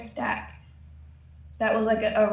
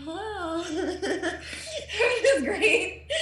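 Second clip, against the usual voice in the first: laughing stoned awe; 8 semitones higher, much slower than usual. Retold or imitated.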